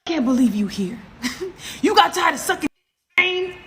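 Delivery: high, loud mock tirade; imitated angry rant. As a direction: spaz out like the angry ex, pitch climbing. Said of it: "he starts spazzing out on me".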